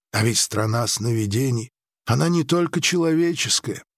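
usual speaking voice

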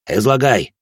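moderately barked command; barked order. A narrator's reading. Officer orders the policeman to report, mildly barking. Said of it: "рявкнул Морозов".

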